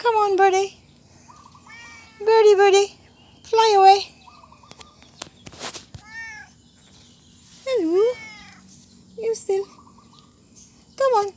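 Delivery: high, sweet coaxing voice to a pet. Real, not acted. Coaxing a bird to fly.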